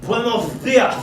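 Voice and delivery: slow hammed-up Spanish accent. Mock Spaniard questioning the servant, in a slow Spanish accent. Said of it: "another one is coming".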